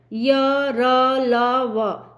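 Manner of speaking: य, र, ल, व said one by one, each letter separate and clear; letters sounded out. Shown as a demonstration. Teacher demonstrating य, र, ल, व for students, each letter separate. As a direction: sound out य, र, ल, व slowly for the class. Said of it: "बोल के देखें आप".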